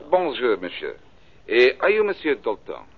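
hammed-up French accent, posing as a Frenchman. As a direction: play a smooth Frenchman with a stage French accent. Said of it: "Gonna try out your French accent?".

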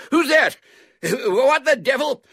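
very high, loud startled yell; angry shout. A narrator's reading. Man locked in shed, shouting angrily. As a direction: shout in outrage. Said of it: "came an angry shout from inside".

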